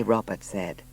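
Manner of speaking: ordinary delivery, nothing marked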